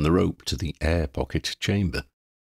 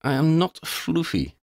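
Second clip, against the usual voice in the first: a proud cat offended, lightly higher; lightly voiced huffy denial; indignant protest; 10 semitones higher than usual. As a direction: stress on "not" in "I am not floofy". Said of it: "Xander protested".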